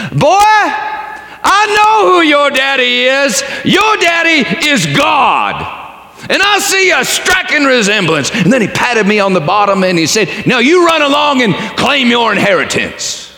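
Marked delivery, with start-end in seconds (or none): 0.1-8.3 slow, high, loud booming declaration; really loud. Acted. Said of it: "really loud". 10.5-13.3 big preacher voice. Acted. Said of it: "The preacher's man, he said".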